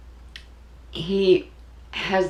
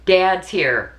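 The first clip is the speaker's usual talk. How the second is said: louder, firm announcement; son's message; 4 semitones higher, 7 dB louder than usual. Retold or imitated. Mother repeating her son's words, louder and firm. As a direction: ring it out clearly. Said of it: "He said".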